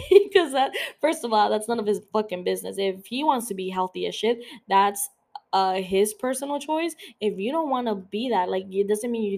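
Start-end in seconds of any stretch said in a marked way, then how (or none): none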